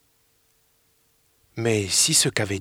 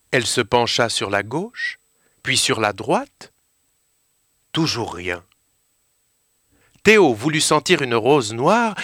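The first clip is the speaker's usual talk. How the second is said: plain reading voice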